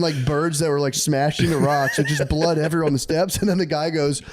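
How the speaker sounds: ordinary talk, nothing marked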